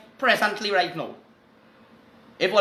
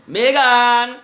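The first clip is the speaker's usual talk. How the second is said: calling out; 3 semitones higher, 7 dB louder than usual. Real, not acted. Host calls to Megan after her note.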